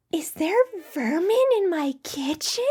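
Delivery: put-on high, suspicious old hag; sharp hag demanding answers. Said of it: "the blind hag is like".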